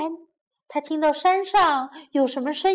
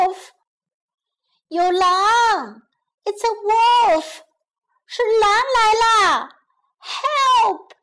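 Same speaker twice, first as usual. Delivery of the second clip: high, loud cries for help; feigned panicked shout; 8 semitones higher, 5 dB louder, slower than usual. A narrator's reading. Shepherd boy fakes a wolf attack to villagers, panicked shouting. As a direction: shout as if terrified, pitching it right up. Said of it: "孩子装出非常惊慌的声音，大喊".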